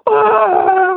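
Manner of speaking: loud high scream; terrified scream. Retold or imitated. Kicking in the tent, thinking a bear attacks, loud high scream. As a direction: scream as if being mauled. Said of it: "kicking and screaming".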